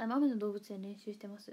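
ordinary delivery

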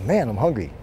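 usual speaking voice

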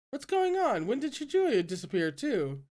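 sudden high startled cry; surprised exclamation. A narrator's reading. Disciple noticing a friend gone, surprised. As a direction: blurt it out in shock. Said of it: "exclaimed in surprise".

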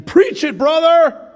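high, loud call of praise; shouted encouragement. Retold or imitated. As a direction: shout it from the pew. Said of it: "If someone's constantly saying".

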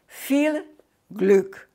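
ordinary talk

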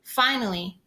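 plain reading voice

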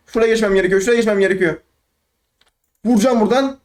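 normal talking voice

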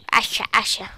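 playful little voice on a repeated word; silly little voice. Real, not acted. Player naming the axe to herself, silly little voice. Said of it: "No sé por qué pongo esa vocecita".

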